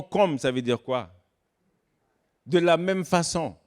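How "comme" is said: «comme» said higher with a firm fall; «comme» pitched up and dropped; stressed word. Shown as a demonstration. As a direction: lean on «comme» as the key word. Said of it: "le mot".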